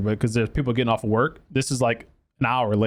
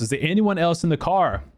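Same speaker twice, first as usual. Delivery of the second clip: higher-pitched, urgent repeated question; insistent retold yell; 6 semitones higher than usual. Rescuer asking unresponsive girl about passengers, insistent high call. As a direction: press for an answer, imitating a call. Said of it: "and she wouldn't answer".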